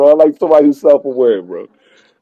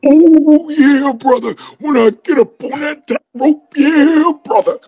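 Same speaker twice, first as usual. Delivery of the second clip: very high, strained wrestler impression; hammed-up wrestling promo, stretching out the catchphrase; Macho Man; 12 semitones higher, slower than usual. Wrestler boasting about the top rope, high hammed-up impression. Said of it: "Macho Man's freaking hard".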